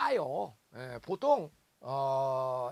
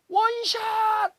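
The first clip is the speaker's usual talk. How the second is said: higher, louder golf cheer; excited cheer; 11 dB louder, much slower than usual. Pretend. Golf moms cheering a friend's drive, excited and loud. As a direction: cheer a great shot.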